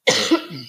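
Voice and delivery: sudden real cough mid-sentence; coughing fit. Real, not acted. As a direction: cough hard, then carry on. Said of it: "coughing so much today".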